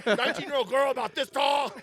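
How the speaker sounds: high, laughing mock frantic father; worried dad. A fake dad asking ravers about his missing daughter, high and laughing. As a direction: ask the crowd like a dad who lost his girl. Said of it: "I'm like".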